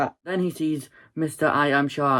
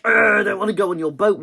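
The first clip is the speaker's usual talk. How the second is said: higher, louder sneering put-on voice; old man mocking rich people with a sneer; snooty rich people; 5 semitones higher, 4 dB louder than usual. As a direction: sneer like a snob. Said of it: "they're like".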